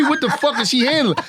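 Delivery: high, outraged disbelief; stunned and jealous; high incredulous. Retold or imitated.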